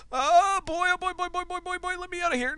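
high, soft, rapid repeated muttering; rising panic in a small high voice; panicky babble. Real, not acted. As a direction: babble nervously while trying to escape. Trapped, wants to escape.

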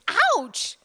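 sudden high loud ouch; yelp of pain. A narrator's reading. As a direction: yelp as if bitten. Orderly bitten on the finger.

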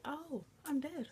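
drawn-out, high-swooping mock realization; mock dismay. Girl falling to her death, mock dismay. Acted reaction of falling character.

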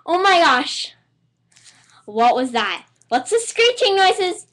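startled. Real, not acted. Startled by strange noises.

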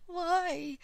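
giddy. Real, not acted. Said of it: "I don't know why I'm giggling".

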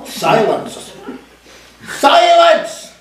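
building to a piercing high screech; commanding screech building to a shrill peak. A narrator's reading. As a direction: start firm, then shriek it out. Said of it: "screeched the most commanding voice".

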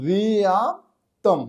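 "রিয়াপ্তম" slowly as long vi-aap, pause, tam; broken-down pronunciation. Shown as a demonstration. Teacher spelling out the sounds again, slow broken-up pronunciation. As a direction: draw out "রিয়াপ্তম" part by part, starting on v. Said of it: "সেটা হচ্ছে".

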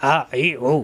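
আ, ই, উ pressed from the middle of the throat; squeezed ain vowels. Shown as a demonstration. Teacher repeating the ain drill, আ, ই, উ with throat pressure. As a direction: tighten mid-throat on each of আ, ই, উ. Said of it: "কণ্ঠনালীর মাঝখান থেকে উচ্চারণ".

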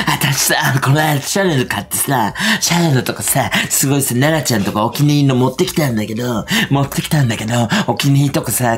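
lightly imitated chatty woman; a hint of someone chattering on without a break; Aiko. Friend going on about her Chanel purchase, lightly mimicked chatter. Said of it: "あいこちゃんさ、".

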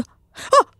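sudden high yelp; startled yelp. Real, not acted. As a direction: jump at a scare. Jump scare reaction. (깜짝 놀란 외마디)

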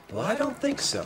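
a lightly voiced smug expert; imitating Nick. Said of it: "he usually starts those phrases with".

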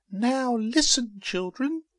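very high, squeaky plant voice; high-pitched character addressing her young; motherly little plant. A narrator's reading. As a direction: call the children to attention, pitched right up. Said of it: "she said to them".

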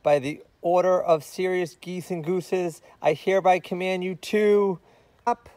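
high, loud pompous proclamation; hammed-up royal order; mock official decree. A narrator's reading. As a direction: command it like a herald.

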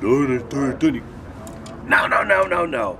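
high, loud volley of refusals; emphatic interruption. Retold or imitated. Director stops actor's reading, loud mock interruption. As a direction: imitate a director cutting the actor off.

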